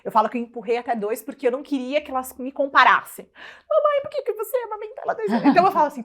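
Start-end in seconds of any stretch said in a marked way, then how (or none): 3.7-5.5 put-on child voice. Daughter's question voiced in story.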